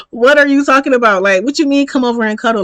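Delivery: acted-out outraged confusion; loud disbelief. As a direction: retort as if they've lost their mind. Acted disbelief at cuddle request.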